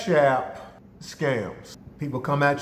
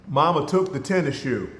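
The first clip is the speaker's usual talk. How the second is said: ordinary talk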